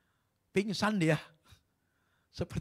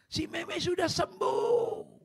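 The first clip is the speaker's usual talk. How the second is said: high, slow, loud proclamation; preacher exults that the girl is healed; joyful shout; 5 dB louder, much slower than usual. Acted. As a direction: shout the good news to the church. Announcing the healing joyfully.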